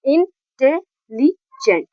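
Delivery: Intelligent slowly chopped into इन, टे, लि, gent; four separate syllables. Shown as a demonstration. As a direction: demonstrate four beats in intelligent, a short gap after each. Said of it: "There are four syllables in this word".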